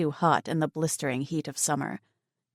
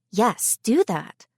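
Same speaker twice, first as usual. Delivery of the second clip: encouraging friend; 4 semitones higher, 4 dB louder, slower than usual. Acted. Tegan agrees.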